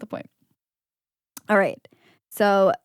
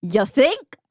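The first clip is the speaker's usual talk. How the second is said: higher, rising catchphrase voice; 5 semitones higher, 5 dB louder than usual. Acted. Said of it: "I should have looked up [name] phrases".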